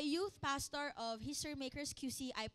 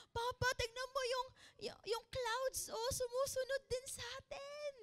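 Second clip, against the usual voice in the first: put-on excited child; 8 semitones higher than usual. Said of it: "Sabi niya".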